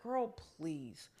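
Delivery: low, soft, drawn-out retort; languid, not dismissive. Retold or imitated. She answers the threat to hurt her, languidly unbothered. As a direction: let it sink low and unhurried. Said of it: "isn't dismissive".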